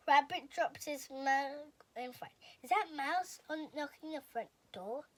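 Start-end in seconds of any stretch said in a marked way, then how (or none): none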